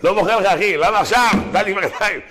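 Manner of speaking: loud, high-pitched comic outburst; mock outraged protest. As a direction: wail the complaint at full volume, played for laughs.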